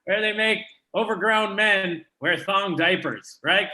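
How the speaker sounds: normal talking voice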